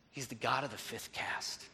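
low, soft statement of faith; understated reverent reply; quiet, assured answer. Retold or imitated. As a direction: say it hushed, as a simple certainty. Son's quiet answer, retold.

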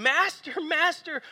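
high, loud, desperate cry for help; panicked shout. Retold or imitated. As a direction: yell as if the boat is sinking. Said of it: "woke him up shouting".

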